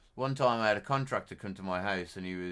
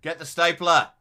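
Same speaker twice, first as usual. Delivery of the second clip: shout; 9 semitones higher, 13 dB louder than usual. Real, not acted. Frantic game moment.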